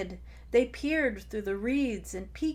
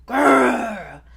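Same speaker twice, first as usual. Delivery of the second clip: loud dinosaur growl; 3 semitones higher, 15 dB louder than usual. Acted. Tai growls at his pest sister, loud growl. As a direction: growl like an angry T. rex.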